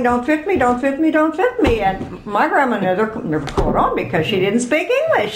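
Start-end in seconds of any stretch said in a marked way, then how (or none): 0.0-1.8 higher-pitched, repeated singsong response; child repeating litany. Retold or imitated. Child in church echoing misheard German, repeated in a higher voice. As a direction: chant it over and over like a child. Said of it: "I just kept saying".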